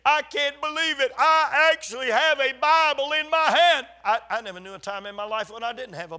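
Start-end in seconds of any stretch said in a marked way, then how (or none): 0.1-3.9 loud, high, overjoyed exclamation, retold; retold amazement. Lady handed a Bible in Romania, retold joyful disbelief. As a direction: proclaim it like someone overwhelmed, imitating her. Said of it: "started kissing it and saying".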